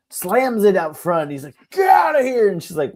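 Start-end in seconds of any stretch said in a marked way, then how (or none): 1.7-2.5 high, loud mock yell; screaming neighbor. Neighbor orders girlfriend off, mock angry scream. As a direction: bellow it like the irate neighbor. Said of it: "she hears him screaming to himself".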